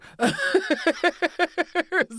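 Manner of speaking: loud high laugh breaking out; loud high laugh at a courtroom ovation; high shrieking laugh. Real, not acted. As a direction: cackle loud and high in disbelief.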